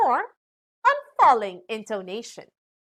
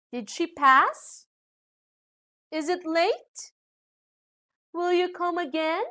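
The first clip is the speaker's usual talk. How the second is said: yes-no questions, pitch lifting on "pass", "late" and "again"; rising intonation; 6 semitones higher than usual. Shown as a demonstration. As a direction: end "pass", "late" and "again" on a clear upward swing. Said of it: "when we ask questions answerable by yes or no".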